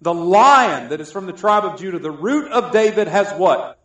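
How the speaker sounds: loud proclaiming of scripture; preacher proclaiming the Lion of Judah loudly; preaching. Acted. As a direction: build it like a herald's announcement. Proclaims scripture at full force.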